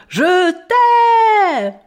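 high, loud shouted je t'aime; boy yells to the panda over the film. A narrator's reading. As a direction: shout it over the screaming on screen. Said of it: "je dis bien fort".

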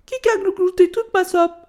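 high, loud little-bear whine; whimpering little bear. A narrator's reading. Ch'ti Ours finds all his soup eaten, whining. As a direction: pipe it up as a tearful cub. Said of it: "pleurchigna Ch'ti Ours".

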